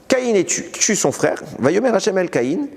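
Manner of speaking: usual speaking voice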